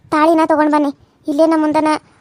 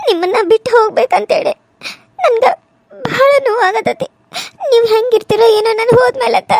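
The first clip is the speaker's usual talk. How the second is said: higher, tearful voice breaking into sobs; tearful crying; 7 semitones higher than usual. Acted. New bride crying to her parents as she leaves home, voice breaking with tears. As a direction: cry through the words, not wanting to leave. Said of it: "ಯಾಕ್ ಅಳಾಕತ್ತಿದಿ?".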